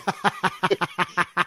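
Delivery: high, breathy real laugh; real amused laugh. Real, not acted. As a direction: burst out laughing at the memory. Laughing at the clip.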